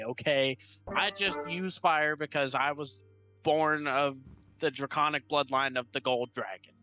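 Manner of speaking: ordinary delivery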